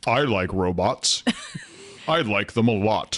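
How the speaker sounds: slow, halting Obama voice; a hint of Obama's measured pauses; Obama impression. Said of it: "Now, now do Obama".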